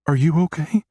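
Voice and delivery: tiny high voice on the verge of tears; rising child's tone. A narrator's reading. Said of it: "Her tone increases".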